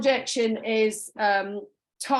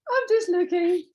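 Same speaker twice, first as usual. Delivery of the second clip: higher, hammed-up customer brush-off; mock customer; 8 semitones higher, 3 dB louder than usual. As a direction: play the dreaded browser, a bit louder.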